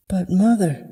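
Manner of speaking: put-on high-pitched girlish plea; protesting daughter. Daughter protests being sent to the closet, high plea.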